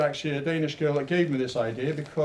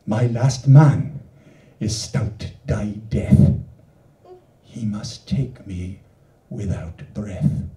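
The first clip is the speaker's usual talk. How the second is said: slow, lower, somber reading; solemn slow verse; 4 semitones lower, 3 dB louder, much slower than usual. Acted. Poet reading the death stanza to the audience, slow and somber. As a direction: let Death's lines sink slowly.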